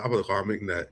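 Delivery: ordinary talk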